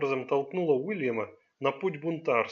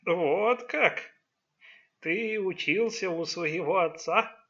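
slow, higher, mock-innocent question; feigned surprise; 3 semitones higher, slower than usual. A narrator's reading. Old father baits his son, feigning surprise. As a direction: act mildly amazed, drawing it out. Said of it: "Сделанным удивлением спросил Джан Цзы Ци".

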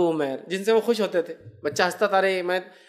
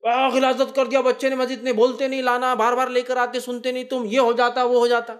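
mock complaining elder; 5 semitones higher than usual. Imitating an irritated worshipper complaining about the child's mess. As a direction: whine that the child soiled the mosque.

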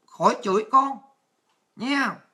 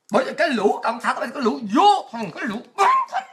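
angry cursing. Real, not acted. Streamer cursing the Communist Party at the end of her rant, angry. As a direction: spit out insults, rising to a heated finish.

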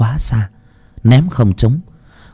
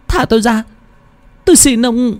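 even higher, desperate begging cry; a terrified girl pleading with everything she has; the plea climbs higher, near a wail. Acted. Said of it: "Tiếng cô gái kêu khóc thảm thiết".

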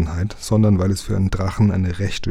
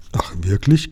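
usual speaking voice

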